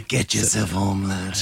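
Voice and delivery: low, husky Scouse manager; hoarse Scouse impression. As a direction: drop it deep and hoarse in a Scouse accent. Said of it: "deeper and horse, more horse than that".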